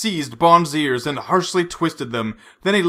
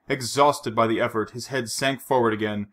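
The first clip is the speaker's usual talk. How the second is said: ordinary delivery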